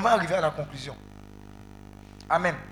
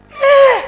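very high, loud "eh!"; exclamation aiguë; 15 dB louder than usual. Real, not acted. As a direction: let out a sudden high-pitched cry. Exclaims after recalling begging schoolmates. (cri aigu d'exclamation)

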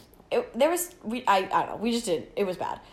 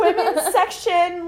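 store announcement; 7 semitones higher, 4 dB louder, slower than usual. Retold or imitated. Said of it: "she grabs the speakerphone".

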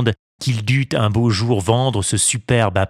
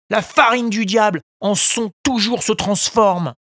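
high voice, preachy proverbs; a high little puppet moralizing smugly at the beggars; scolding puppet; 12 semitones higher than usual. A narrator's reading. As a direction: puppet lecturing the crooks. Pinocchio lecturing beggars.

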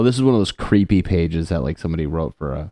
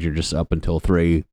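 normal talking voice

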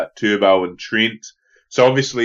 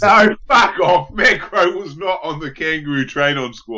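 incredulous protest; 7 semitones higher than usual. Real, not acted. Disputing co-host's footy claim.

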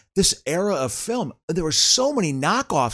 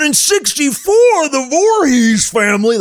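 high, loud, slow warning shout; ranting town crazy; crazy townie; 11 semitones higher, 5 dB louder than usual. Retold or imitated. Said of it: "there's Crazy Townie".

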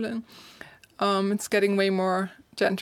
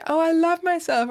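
higher and a bit louder, sugary mock affirmation; mock fake self-love; 8 semitones higher, 4 dB louder than usual. Mocking people's fake mirror affirmations, sugary. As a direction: parody a cheesy mirror affirmation. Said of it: "so fake when people stand in front of the mirror".

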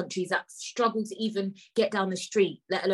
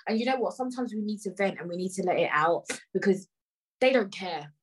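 ordinary talk

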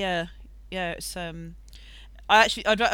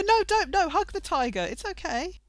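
high-pitched silly voice; squeaky voice; high mock panic; 10 semitones higher than usual. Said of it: "just him singing and then go".

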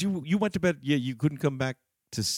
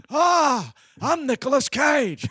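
much higher, louder, slow Nicolas Cage attempt; bad Nicolas Cage; 14 semitones higher, 6 dB louder, much slower than usual. Shown as a demonstration. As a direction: ham up a terrible Nicolas Cage, high and drawn out. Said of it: "the worst Nicolas Cage".

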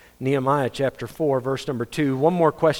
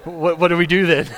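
mock confused listener; 3 dB louder than usual. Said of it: "You're like".

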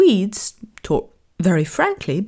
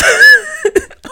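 a high laughing shriek; loud laugh; 9 dB louder than usual. Real, not acted. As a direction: burst out laughing. Laughs at punchline.

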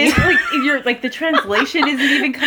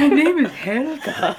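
slow hammed-up German introduction; stretched-out fake German accent; mock German accent; 2 semitones higher, slower than usual. Friend joking about the German date, mock German accent. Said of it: "How do you say".

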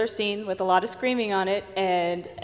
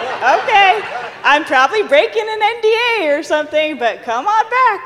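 flustered eager agreement; 9 semitones higher, 7 dB louder than usual. Retold or imitated. Letting the cops in, flustered.

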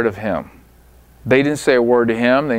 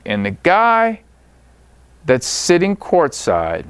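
ordinary talk

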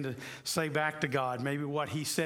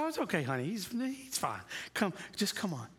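encouraging; 4 semitones higher than usual. Retold or imitated. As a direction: soothe a startled child, pitched up and gentle. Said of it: "just encouraging her".

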